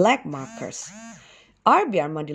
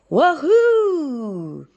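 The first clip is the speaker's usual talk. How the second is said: cheering; 9 semitones higher, 4 dB louder than usual. A narrator's reading. Armadillo cheers after escape.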